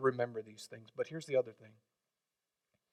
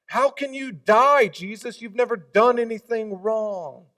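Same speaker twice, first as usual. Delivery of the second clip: higher, louder, slow astonished plea; amazed protest; 8 semitones higher, 7 dB louder than usual. Acted. Believer protesting to Jesus, high amazed plea. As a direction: plead in disbelief. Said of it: "The attitude of you and I should be that".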